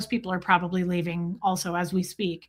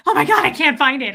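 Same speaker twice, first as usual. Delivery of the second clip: loud, higher panicked outburst; mock panic; 4 semitones higher, 8 dB louder than usual. Streamer imitating themselves hunting for a viewer's comment, mock panic. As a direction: act out a frantic, breathless search. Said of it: "I start panicking".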